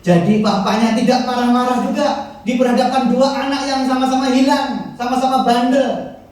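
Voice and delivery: loud, high preaching; preaching at full force. Acted. Preacher to congregation, loud high preaching. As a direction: drive the point home to the congregation.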